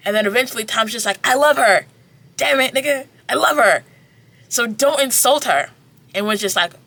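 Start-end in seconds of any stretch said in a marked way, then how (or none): 1.2-5.7 louder, higher impassioned declaration; heated confession. Retold or imitated. Time shouting at Ren that he loves her, louder and higher. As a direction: blurt it out hot.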